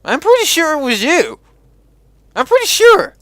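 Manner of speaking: loud, high-pitched protest; squeaky, disbelieving contradiction; high-pitched insistence. Real, not acted. Gamer disputes a character's denial.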